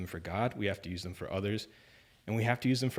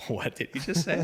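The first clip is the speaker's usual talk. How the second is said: high, baffled inner thought; like you can't believe your ears; stunned disbelief; 8 semitones higher than usual. Retold or imitated. His own stunned reaction in the crowd.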